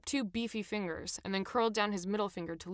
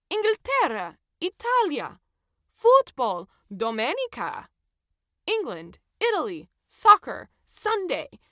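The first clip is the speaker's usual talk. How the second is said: loud, high, word-by-word Italian delivery; spelling it out for foreigners; Italian ticket checker spells out simple words to the tourists; Italian-accented checker; 7 semitones higher, 9 dB louder than usual. A narrator's reading.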